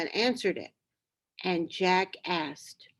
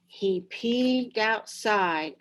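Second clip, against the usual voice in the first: usual speaking voice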